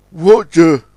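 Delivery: a touch of alarm; startled Warrior. A narrator's reading. Watches in horror as tugs pass.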